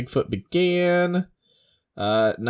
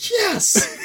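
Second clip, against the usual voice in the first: high, gleeful imitated cheer; thrilled high-pitched retelling; perked-up excitement. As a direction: squeal it in mock triumph, laughing. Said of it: "She instantly perks up".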